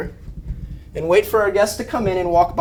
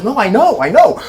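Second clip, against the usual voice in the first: dog's voice; 3 semitones higher, 5 dB louder than usual. Acted. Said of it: "And the dog goes".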